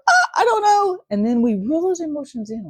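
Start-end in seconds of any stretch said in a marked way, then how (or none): 0.1-1.0 high, loud cry of not knowing; mock-panicked exclamation; flustered outburst. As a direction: throw up your hands in a sudden panicked cry. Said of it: "you're going".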